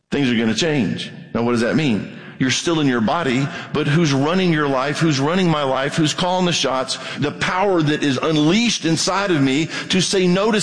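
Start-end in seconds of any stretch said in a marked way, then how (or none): none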